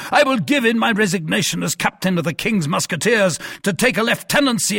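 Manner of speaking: raging, shouting captain, pitch climbing high; furious captain. A narrator's reading. Captain storming at his musketeers, furious shout. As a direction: bellow it in outrage at the cardinal.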